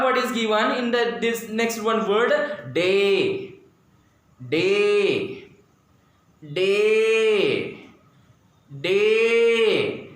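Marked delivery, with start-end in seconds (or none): 2.7-10.1 DAY with a long, held vowel; drawn-out pronunciation. Shown as a demonstration. Teacher sounding out a word for students, DAY drawn out. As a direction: say DAY slowly, stretched out and evenly held. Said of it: "When we pronounce".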